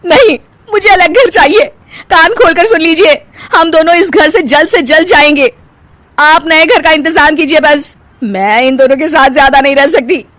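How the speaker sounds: high, loud, upset insistence; tearful demand. Acted. Wife demanding a separate house, tearful and insistent. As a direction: insist through indignant tears.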